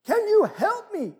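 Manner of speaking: high, loud plea for help; panicked tourist. Acted. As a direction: plead up high, calling up the cliff. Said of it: "And the tourist says".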